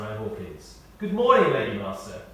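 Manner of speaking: ordinary talk, nothing marked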